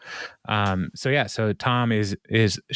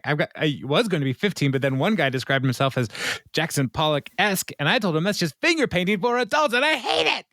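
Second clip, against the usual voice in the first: rising, fast quote of Craig getting higher; imitating Craig; 7 semitones higher, faster than usual. Host imitating Craig complaining about an artist, getting higher and faster. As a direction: Craig ranting about a Pollock wannabe. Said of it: "He's like".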